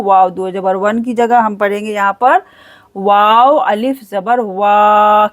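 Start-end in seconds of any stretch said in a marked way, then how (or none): 4.4-5.3 वा with a long held aa; lengthened, level वा; stretched waa. Shown as a demonstration. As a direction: draw out the vowel of वा. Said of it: "खींच कर पढ़ेंगे".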